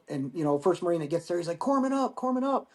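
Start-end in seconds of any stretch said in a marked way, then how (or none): 1.6-2.7 high, repeated field callout; raised-pitch retold call, not full volume; calling for help. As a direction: imitate a Marine hollering for the medic. Marine calling for medic, retold.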